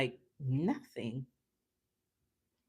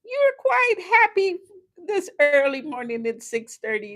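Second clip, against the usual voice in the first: much higher and louder, mock-amazed driver; 12 semitones higher, 9 dB louder than usual. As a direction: imitate the driver's astonishment. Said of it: "He said to me".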